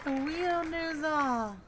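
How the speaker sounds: lightly hammed-up prophecy; mystic oracle voice. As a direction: intone it like a fortune teller. Repeats the line in a put-on voice.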